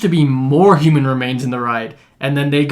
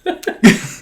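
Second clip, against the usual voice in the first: genuine, higher burst of laughter; real laugh; 7 semitones higher than usual. Real, not acted. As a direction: let a real laugh break out. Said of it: "and then I real laugh".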